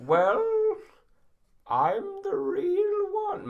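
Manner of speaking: high, loud, slow indignant put-on voice; Tim Curry voice. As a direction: insist loudly in a hammy star voice. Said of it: "his voice doesn't sound real".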